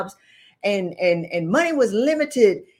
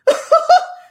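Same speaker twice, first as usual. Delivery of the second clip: sudden loud laugh, much higher; 10 dB louder than usual. Real, not acted. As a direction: burst out laughing. Laughing at companies doing nothing.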